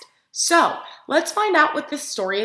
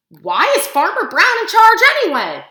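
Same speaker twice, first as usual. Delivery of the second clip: higher, louder indignant duck; Duck's voice; 8 semitones higher, 8 dB louder than usual. A narrator's reading. Reading Duck's grumbling thought aloud to kids, higher and louder. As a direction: protest like a fed-up duck. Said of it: "thought Duck".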